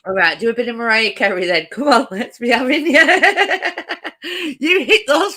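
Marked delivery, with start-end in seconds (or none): none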